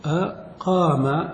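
the word stretched out slowly; slow demo. Shown as a demonstration. Teacher slowly stretching the real form. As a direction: let the class hear every sound. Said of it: "الذي حدث أنهم قالوا أقام".

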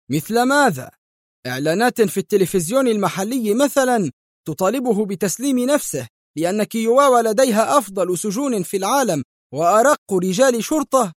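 high-pitched edgy sarcasm; irritable, agitated. A narrator's reading. Michael ridiculing the governor's plan, irritably. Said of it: "قال مايكل في عصبية".

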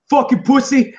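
high, loud mocking insult; mock taunting yell. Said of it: "he's like".

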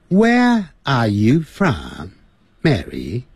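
slow British English, R's dropped; British accent. Shown as a demonstration. Teacher demonstrates the British question to Mary, British accent. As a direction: ask it in a British accent, drawn out. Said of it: "在英式英语".

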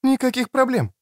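high-pitched woman's assurance; Креция's voice. Acted. Woman assures them all is well, high-pitched. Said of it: "сказала Креция".

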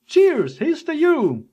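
high, hearty drinker's toast; mock toasting. Thanking someone for a loan, high mock toast. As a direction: lift the voice high into a hammed-up toast. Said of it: "they're toasting".

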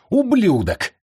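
high-pitched angry cry; furious insult. A narrator's reading. Bound commander struggles against his ropes, furious. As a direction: hurl the curse high.

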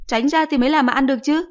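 high, loud irritated snap; a mother telling her son off; shrill-free loud mother scolding. Acted.

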